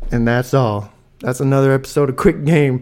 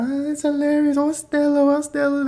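much higher falsetto, delighted teacher voice; gushing teachers. Retold or imitated. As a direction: coo over the baby like a charmed teacher. Teachers gushing over the dancing baby.

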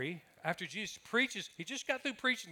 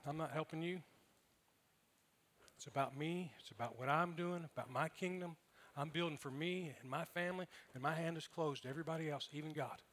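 selfish voice; 8 dB quieter than usual. Retold or imitated. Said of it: "it comes from selfishness".

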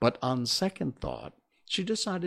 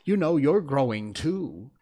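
higher, louder comeback; raised, confident retort; bold retort; 4 semitones higher, 6 dB louder than usual. A narrator's reading. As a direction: answer back boldly. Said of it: "said Alice more boldly".

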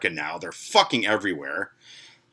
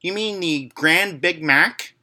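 high, slow, prim correction; correcting teen girl; 9 semitones higher, 3 dB louder, slower than usual. Retold or imitated. Sixteen-year-old cashier correcting the narrator, high and slow. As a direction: spell it out like a know-it-all teen. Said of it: "sixteen-year-old girl correct me".

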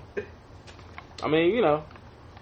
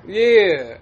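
ordinary talk, nothing marked

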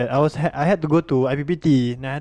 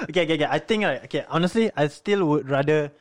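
ordinary talk

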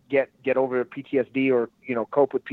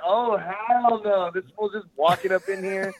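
hell no; 6 semitones higher than usual. Retold or imitated. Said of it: "everybody was like".

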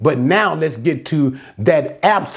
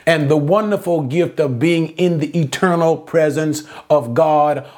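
ordinary delivery

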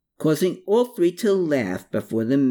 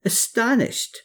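high, astonished mild yelp; 12 semitones higher than usual. A narrator's reading. Man admitting his astonishment, yelping. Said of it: "he yelped".

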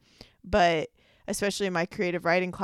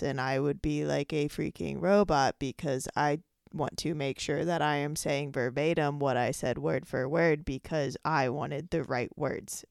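ordinary delivery

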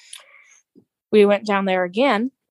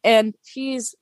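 ordinary delivery

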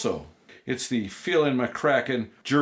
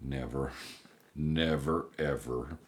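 dejected deadpan; 11 semitones lower, 9 dB quieter, slower than usual. Acted. Deadpan sad answer.

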